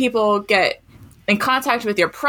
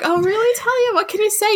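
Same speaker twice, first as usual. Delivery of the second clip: high-pitched, interested teacher voice; indulgent teacher; 11 semitones higher, faster than usual. Retold or imitated. Teacher answering a boastful kindergartner, high and encouraging. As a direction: humour a small child with lifted questions.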